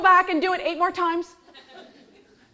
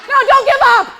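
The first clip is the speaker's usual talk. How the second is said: loud and higher, laughing; laughing sarcasm; 11 semitones higher, 7 dB louder, faster than usual. Retold or imitated. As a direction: cackle through the taunt. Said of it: "what they must hear".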